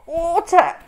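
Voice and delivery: high loud gasping plea for water; choking cry. A narrator's reading. Henry choking on string bean, loud plea. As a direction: beg as if dying.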